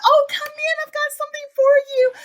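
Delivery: high, sing-out welcome; gushing old lady. Retold or imitated. Little old lady welcoming the kids in, gushing. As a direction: fuss over visitors in a high, quick voice. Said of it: "the lady just makes over Lily and Matt".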